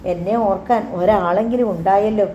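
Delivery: ordinary delivery, nothing marked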